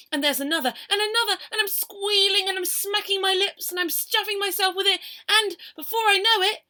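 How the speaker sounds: high, loud gobbling excitement; frenzied excitement. Acted. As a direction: build a greedy frenzy. Can't stop eating the cake.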